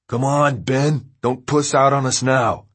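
loud taunting call. A narrator's reading. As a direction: call out, goading him. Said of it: "Trey called".

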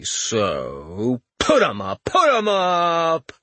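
slow, theatrical lion voice; Cowardly Lion voice. Acted. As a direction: ham it up like a cowardly stage lion. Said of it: "his voice was a dead ringer for the cowardly lion from Oz".

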